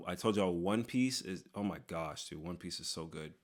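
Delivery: normal talking voice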